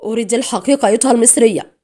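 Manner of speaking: loud, savage demand; savage shout. A narrator's reading. Interrogator to her captive after slapping her, savagely shouted. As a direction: bark it out brutally. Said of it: "وهي تصرخ في وحشية".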